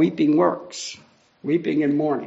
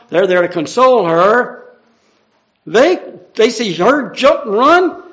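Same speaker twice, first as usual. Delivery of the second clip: loud, driving sermon voice, pushing the story forward; full-force preaching; 4 semitones higher, 7 dB louder than usual. Acted. Preacher to congregation, telling the Lazarus story at full force.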